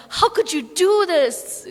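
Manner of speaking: high, drawn-out complaint; mock indignant protest. Devotee protesting to Krishna when the mercy comes, mock indignant. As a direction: wail it slowly in mock outrage.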